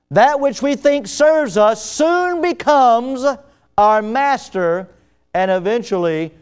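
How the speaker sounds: high, loud preaching that builds; emphatic pulpit build; forceful preaching. Real, not acted.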